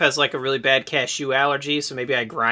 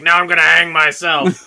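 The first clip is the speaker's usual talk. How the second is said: mock exasperated announcement; 3 semitones higher, 5 dB louder than usual. Acting out the exasperated dad.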